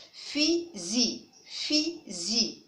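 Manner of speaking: fusil split slowly, fu / sil with a z sound; teacher's careful syllable demo of "fusil". Shown as a demonstration. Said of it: "je vais les découper en syllabes".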